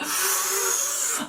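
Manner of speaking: high gasp of relief; a hammed-up gasp of relief; dramatic gasp. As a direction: suck in air as the pain eases. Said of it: "I was literally like".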